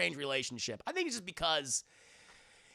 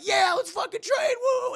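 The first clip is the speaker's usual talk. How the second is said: loud high whooping cheer; rally the gang, yell it; cheering shout; 8 dB louder than usual. Retold or imitated. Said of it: "And they're like".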